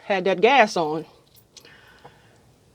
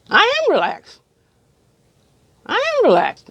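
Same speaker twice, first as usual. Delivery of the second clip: much higher, tense insistence; insistent protest; 11 semitones higher than usual. Retold or imitated. Patient insisting to dentist she is relaxed, high and tense. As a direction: protest that you're fine, pitched way up.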